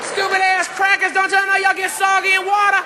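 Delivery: high, incredulous scolding at the screen; heckling moviegoer. Acted. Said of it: "the brother started first".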